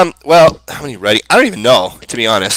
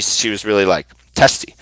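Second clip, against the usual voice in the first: ordinary talk, nothing marked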